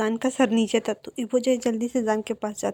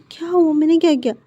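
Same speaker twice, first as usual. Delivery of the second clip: higher, louder startled protest; what did I do, higher and louder; 6 semitones higher, 6 dB louder than usual. Acted. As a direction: caught off guard, protest your innocence. Scolded husband protests innocently.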